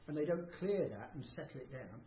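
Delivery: ordinary delivery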